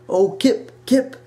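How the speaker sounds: high, loud, gushing Irish granny greeting; Irish grandma accent. Retold or imitated. Grandma calling grandchild on the phone, in a high Irish lilt. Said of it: "in her Irish accent that I can't mimic".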